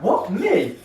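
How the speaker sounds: high, incredulous squeak of disbelief; surprised disbelief. Retold or imitated. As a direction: act shocked at being singled out. Teller stunned at a compliment.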